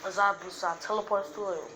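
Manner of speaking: plain reading voice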